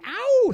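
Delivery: a high, loud "ow" stretched out; mock howl. Comic recalling his thumbs-up moment, mock howl. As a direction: let out a drawn-out "ow!". Voices a cry in the story.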